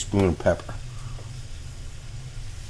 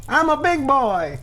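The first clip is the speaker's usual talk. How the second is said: put-on much higher, childlike boast; high mock-proud. Shown as a demonstration. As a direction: say it like a proud little kid. Repeats phrase higher.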